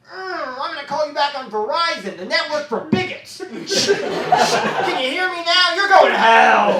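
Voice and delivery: high, loud mock-righteous parent; bigoted parent voice. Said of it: "what if the parents were just like".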